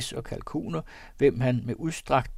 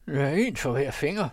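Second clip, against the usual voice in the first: usual speaking voice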